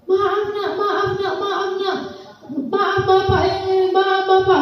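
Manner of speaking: a hint of a father weeping his apologies; crying apology. Father begging his son's forgiveness, softly tearful. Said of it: "Nangis-nangis bapaknya".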